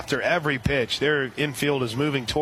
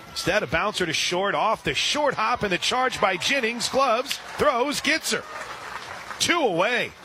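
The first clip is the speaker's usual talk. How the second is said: excited play call; 6 semitones higher than usual. Real, not acted. Radio announcer calling a sharp play at short, pitch climbing. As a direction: voice climbs high as the play unfolds.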